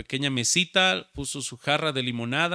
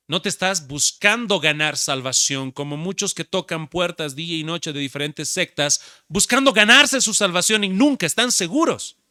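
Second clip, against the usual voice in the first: louder, higher preaching rise; 4 semitones higher, 8 dB louder than usual. Acted. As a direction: build the point up with force. Preaching at full force. (predicando con fuerza creciente)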